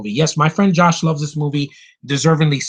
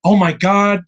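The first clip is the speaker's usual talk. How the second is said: mock surprise; 5 semitones higher, 5 dB louder, slower than usual. Mock shock at DC on list.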